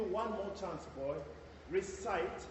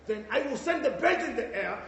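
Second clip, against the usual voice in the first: ordinary talk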